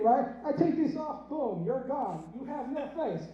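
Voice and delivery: normal talking voice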